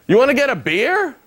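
high, casual question; a hint of a chummy guy; lightly voiced stranger. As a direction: chat up a stranger, pitched high.

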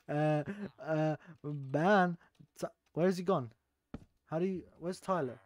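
ordinary delivery

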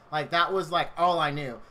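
ordinary talk, nothing marked

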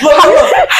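high, loud laughing call; cracking up while yelling look; laughing shout. Real, not acted. Laughing shout telling story.

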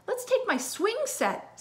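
put-on high, slow little-kid voice; little girl voice. D W asking to bring her swing set, high and slow. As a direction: play a pleading little sister.

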